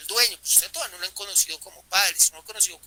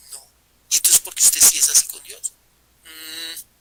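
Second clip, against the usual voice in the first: ordinary delivery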